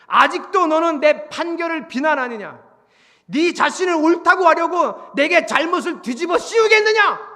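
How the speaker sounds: loud, high, formal rebuke; shouted indictment from on high; thundering divine accusation. Acted. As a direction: roar it as an affronted God. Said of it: "자, 이러고 얘기하세요".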